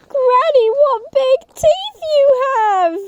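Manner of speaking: put-on high, scared child's cry; frightened girl. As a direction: squeak it out in fright.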